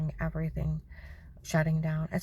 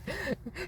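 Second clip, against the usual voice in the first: a high hammed-up gasp of shock; mock gasp; 12 semitones higher, 7 dB quieter than usual. Mocking a grown woman tattling, hammed-up gasp. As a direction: fake a scandalized gasp.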